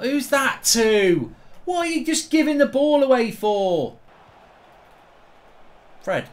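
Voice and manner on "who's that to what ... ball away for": raised, drawn-out protest; exasperated complaint. Real, not acted. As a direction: complain loudly at your own player. Complains about a given-away ball.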